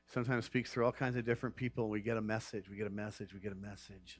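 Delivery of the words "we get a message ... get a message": fading echo. Acted. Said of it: "echoing himself fading".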